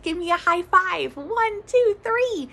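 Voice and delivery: high rising cheerful count; playful counting; excited count. Real, not acted. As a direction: count them up to the high five.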